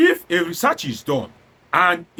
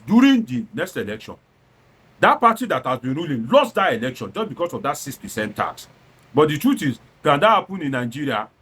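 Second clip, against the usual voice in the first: ordinary talk, nothing marked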